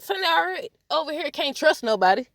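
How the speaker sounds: ordinary talk, nothing marked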